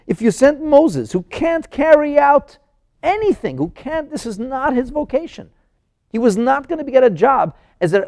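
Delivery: loud, high-pitched arguing; exasperated objection. Acted. Someone objecting that Moses couldn't speak, exasperated and loud.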